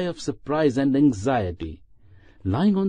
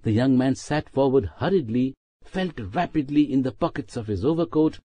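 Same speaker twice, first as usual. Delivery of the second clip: ordinary delivery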